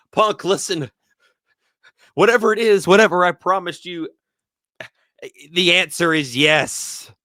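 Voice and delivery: imitating Heyman, loud and high, pleading into the camera; mock Heyman plea. A pundit voices Heyman begging Punk into the camera, loud mock plea. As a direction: ham up a desperate promise, loud.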